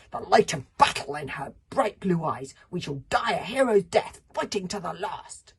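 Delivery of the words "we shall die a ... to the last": loud, heroic, slowing at the end; defiant. Acted. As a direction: proclaim a hero's last stand. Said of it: "sang out Kamikaze defiantly".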